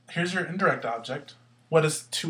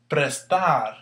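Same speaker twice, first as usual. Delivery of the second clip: "Prestar" with the second syllable punched; "Prestar", loud drawn-out final syllable; stress on last syllable; 5 dB louder than usual. Shown as a demonstration. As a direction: lean hard on the "tar" in "Prestar". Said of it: "There's the stress".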